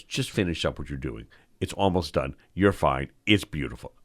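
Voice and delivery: low, soft soothing voice; acted calm encouragement; reassuring nurse. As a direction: talk the nervous trainee gently through it. Nurse reassures panicking trainee.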